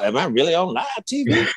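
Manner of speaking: high, incredulous mock question; retold disbelief. Young player on live TV, high incredulous question. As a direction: play up the shock of being on camera. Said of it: "I was like".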